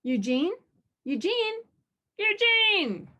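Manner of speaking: rising calls into a high loud shout; calling out. A narrator's reading. Cyclops searching for Eugene, high calling shout.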